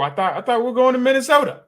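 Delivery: fast, high-pitched loud protest; loud dismay. Real, not acted. Fan learns he can't go to Minnesota, loud dismay. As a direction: blurt it out in high, loud disappointment. Upset the trip misses Minnesota.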